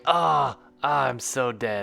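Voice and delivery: loud hammy dying moan; hammy fake death. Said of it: "He's, he's like".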